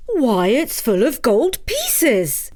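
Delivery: put-on slow, marvelling old cutter; drawn-out wonder at the gold; amazed old man. Takitori finds gold.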